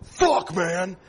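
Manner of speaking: mock bouncer shout; imitated catchphrase yell. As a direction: shout the catchphrase like two teasing fans. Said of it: "Two dudes go".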